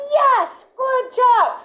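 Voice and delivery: very high, joyful praise; excited praise. Retold or imitated. Trainer praising dog for picking dumbbell, high delighted praise. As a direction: reward the dog with your voice. Praising dog's correct pick.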